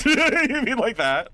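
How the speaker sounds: high, drawn-out laughing squeal; laughing question. Real, not acted. Same question repeated in high laughter.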